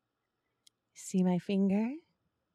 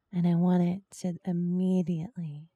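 ordinary delivery

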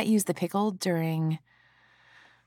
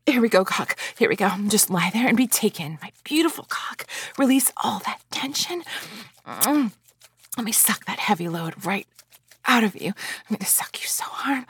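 intense erotic build; 3 semitones higher than usual. Acted. Roleplay climax build.

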